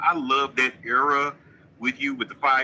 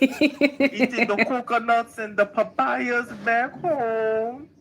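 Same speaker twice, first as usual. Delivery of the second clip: higher-pitched attempt at a Barbados accent; badly faked Barbados lilt, drawn out at the end; mock Barbados accent; 6 semitones higher, slower than usual. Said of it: "that ain't no Barbados accent".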